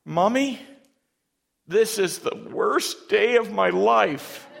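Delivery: put-on high, loud, slowed child's wail of despair. Boy after the first needle wailing to his mother, high and loud. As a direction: cry it out like a heartbroken little boy. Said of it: "he cried out".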